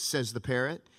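ordinary talk, nothing marked

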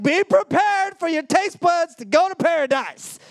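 high, loud showman's promise; grand hype. Retold or imitated. Teen handing mom a muffin, grandly loud hype. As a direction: oversell it like an announcer. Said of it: "They're like".

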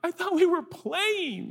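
high, slow, wounded puppy; high-pitched innocent pet voice; hurt dog voice. Acted. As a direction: plead like a confused little dog, drawn out. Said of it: "looking at me like".

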